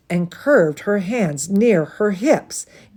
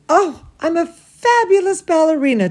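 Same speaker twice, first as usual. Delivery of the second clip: proud delight; 9 semitones higher than usual. Acted. Talulu praising herself at the barre, in a proud, gushing voice. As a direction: gush about yourself like a thrilled little dancer.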